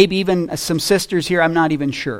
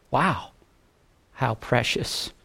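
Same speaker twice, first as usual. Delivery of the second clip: soft, low, awed; quiet marveling; hushed awe; 5 semitones lower, 11 dB quieter than usual. Real, not acted. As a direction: breathe it out in wonder. Awed aside after scripture.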